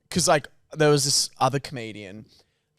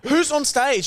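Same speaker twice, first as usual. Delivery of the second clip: shocked demand; 11 semitones higher, slower than usual. Retold or imitated. Asking who's on stage now, high alarmed disbelief. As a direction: shout up in panicked confusion. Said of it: "And I was like".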